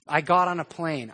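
ordinary delivery

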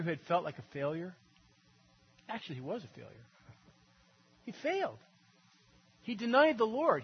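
ordinary talk

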